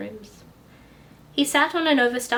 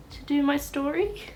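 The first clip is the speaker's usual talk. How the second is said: plain reading voice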